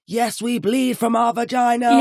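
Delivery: high, loud protest chant; empowered shout. Retold or imitated. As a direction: shout it proudly to the crowd. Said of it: "it was so empowering to be like".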